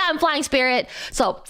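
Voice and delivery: normal talking voice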